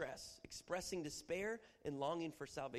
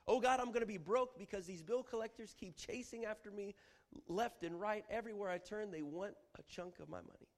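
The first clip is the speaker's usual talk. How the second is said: higher, urgent griping to God; distressed complaint; 7 semitones higher, 3 dB louder than usual. Acted. Preacher acting a prayer about debt collectors. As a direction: complain like the bills are chasing you. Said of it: "portrayal of distress".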